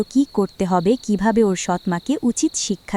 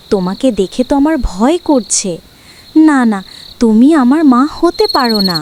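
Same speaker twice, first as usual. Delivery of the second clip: put-on scared defiant girl; 3 semitones higher, 10 dB louder than usual. Girl insists the ugly witch cannot be her mother, loud and scared. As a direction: push the witch away with fear.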